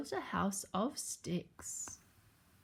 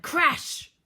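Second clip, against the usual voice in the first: sudden loud storybook crash; exclaimed crash; 8 semitones higher, 7 dB louder than usual. A narrator's reading. Narrator tells of the house falling, sudden exclamation. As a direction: burst out with the crash.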